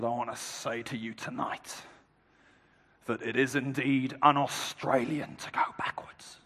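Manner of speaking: very quiet, low imitation of a solemn Australian speech; hushed, leaning into microphone. Cricket captain to a silent dinner crowd, hushed and low. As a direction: drop right down, close to the mic. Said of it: "He leaned into his microphone".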